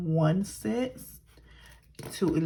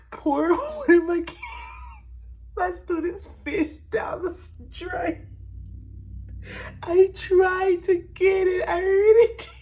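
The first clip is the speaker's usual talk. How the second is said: laugh-crying; 10 semitones higher, 4 dB louder than usual. Real, not acted. Laughing-crying retelling fish loss.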